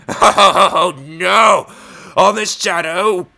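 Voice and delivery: high, loud laughing outburst; laughing shout. Real, not acted. Laughing exclamation.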